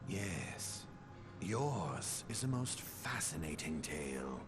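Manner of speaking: usual speaking voice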